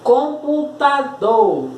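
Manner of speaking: slow enunciation. Shown as a demonstration. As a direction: enunciate every syllable of "Computador" without long breaks. Palavra dita antes de separar. ("Computador" devagar, bem articulado)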